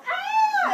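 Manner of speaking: shrieking tooth, hammed up; high mock scream. Voicing the tooth for the kids, high mock shriek. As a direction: squeal way up high like a scared tooth.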